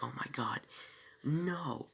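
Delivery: rising into a high, loud cry on no; mock panic. John panicking that the magic is gone, cries out in mock horror. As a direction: play a man losing it as the fountain runs dry. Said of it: "And John's freaking out".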